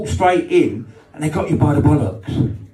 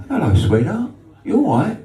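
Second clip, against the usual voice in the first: smooth chat-up; 7 semitones higher than usual. Retold or imitated. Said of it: "I said".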